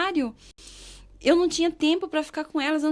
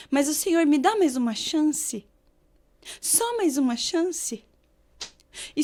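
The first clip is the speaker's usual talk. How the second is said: prayer; slower than usual. Retold or imitated. Retells her prayer in tears.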